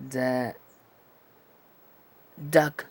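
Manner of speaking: "duck" with the D set further back; D sound demo. Shown as a demonstration. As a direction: demonstrate the D in "duck", tongue placed back. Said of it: "de acá atrás, encajando la, la parte de atrás".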